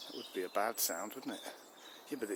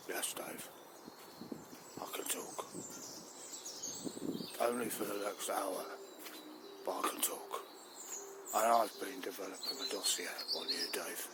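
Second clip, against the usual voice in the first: soft, lowered, half-whispered dog voice; sinister talking dog; 3 semitones lower, 5 dB quieter than usual. Acted. The dog Rover reveals he can talk to Dave, softly sinister. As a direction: speak low and knowingly.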